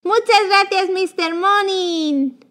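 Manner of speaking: usual speaking voice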